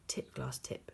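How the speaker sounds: hushed, commanding whisper; whispered order. A narrator's reading. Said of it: "she ordered".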